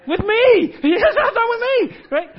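high, loud laughing cry; laughing loud and high at the joke; laughing exclaim. Real, not acted. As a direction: crack up and shout it out. Laughing about Bill and authority.

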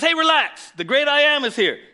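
loud, high-pitched acted reassurance; calling out. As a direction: shout it across the water. Jesus calls to panicking disciples.

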